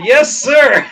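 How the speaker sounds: punched-up yes sir; eager compliance. Retold or imitated. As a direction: acknowledge the order emphatically. Snapping to the new order.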